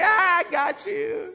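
high, slow shouted taunt; gloating dad. Retold or imitated. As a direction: crow over the startled kid. Dad gloating after scare.

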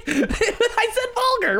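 giggling through the words; laughing. Real, not acted. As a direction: laugh while saying it.

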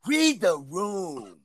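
very slow, softly stretched words; drawn-out proclamation. Pretend. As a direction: draw out every word of the rule. Repeats the rule theatrically.

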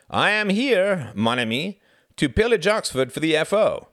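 high, loud, grand announcement; hearty Frederick, declaiming. A narrator's reading. Frederick announces his recruiting mission to Reginald, grandly.

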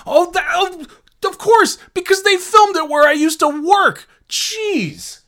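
light up as it hits you; real excited realization. Real, not acted. Remembering the film was shot at his old office, excited.

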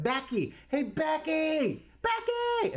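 high-pitched mock holler; yelling across aisles. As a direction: call a coworker from a couple of aisles away. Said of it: "She's yelling across".

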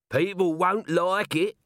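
high, drawn-out character voice; doubtful corporal. Acted. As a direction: warn them it won't go down well.

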